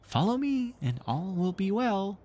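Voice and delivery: very high, slow fairy voice; fairy tinkle. A narrator's reading. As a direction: lure sweetly like a tiny fairy. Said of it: "in her lovely tinkle".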